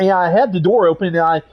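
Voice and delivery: ordinary delivery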